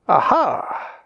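high exclamation of discovery; sudden high "aha"; exclaimed. A narrator's reading. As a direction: exclaim it like a fox finding a trail. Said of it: "exclaimed old Granny Fox".

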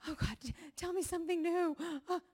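high, gasping plea to God; desperate plea. Acted. Worn-out believer begging God over the Bible, desperate and gasping. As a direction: act out gulping for air as you plead. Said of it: "I can't breathe this morning".

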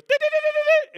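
rapid, very high electronic beeping; imitated sensor beeps. Car sensor warning of a sideswipe, shrill imitated beeps. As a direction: beep like a car alarm, loud and high. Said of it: "have a sensor that goes".

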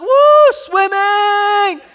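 very high, loud mimicked squeal; child's excited whoop. Dad imitating daughter about swimming, high squealed cheer. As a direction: whoop like an overjoyed kid. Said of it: "She's like".

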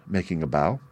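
usual speaking voice

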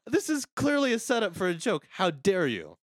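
high, mock-outraged protest; mock indignation. Telling his friend off for missing the joke, mock indignation.

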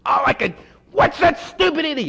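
high, loud mock driver's rage; hammed-up angry yell at another driver; road rage outburst. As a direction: imitate a furious driver who was cut off. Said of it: "this anger just".

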